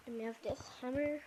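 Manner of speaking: ordinary delivery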